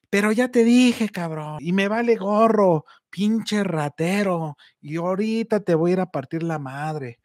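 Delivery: yelling at the thief, then slowing on pinche ratero; angry yelling. Retold or imitated. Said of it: "todos esos gritos".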